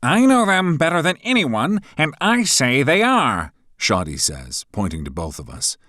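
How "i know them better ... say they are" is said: high, loud fussy professor voice; pompous professor taking charge; stern professor. A narrator's reading. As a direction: announce it like a pompous teacher.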